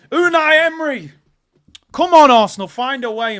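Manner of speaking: high, loud fan's plea; shouted urging. Real, not acted. Fan urging Arsenal to find a way, shouting. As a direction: yell at your team to get going. Urging team on in live match.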